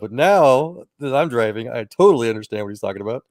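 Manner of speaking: ordinary talk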